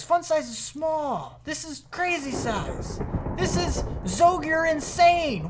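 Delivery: high, drawn-out amazement; over-the-top disbelief. Real, not acted. Gamer gawking at his crazy-sized build, incredulous. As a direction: cry out at an absurd size, stretching the key words. Astonished at huge reactor size.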